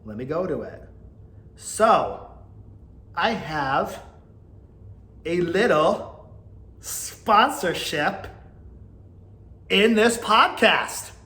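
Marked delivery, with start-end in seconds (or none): 1.6-11.1 slow, louder, rising reveal; drawn-out tease. Acted. Podcaster teasing listeners before the sponsor read. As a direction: stretch each word for the big reveal.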